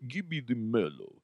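soft, understated Russian accent; deadpan mock Russian; real Russian accent. Shown as a demonstration. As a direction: drop into a serious Russian voice. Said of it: "do a real Russian accent".

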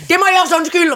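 high, loud re-enacted call; shouted apology. Shopper returning the spices, mock shouted apology. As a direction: imitate yelling sorry back into the shop. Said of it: "råbte jeg".

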